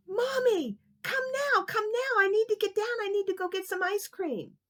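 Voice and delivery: very high, urgent pleading; a kid desperate to get down; pleading little boy. Acted. Kevin pleading from the tree, very high urgent voice.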